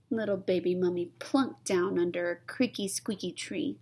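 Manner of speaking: ordinary delivery, nothing marked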